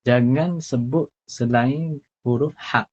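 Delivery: ordinary delivery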